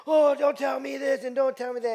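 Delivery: high, loud shouted retort; retold drunken yelling. Drunk father arguing with the older lady, imitated yelling. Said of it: "he's yelling at her".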